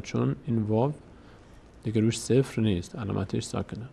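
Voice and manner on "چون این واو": normal talking voice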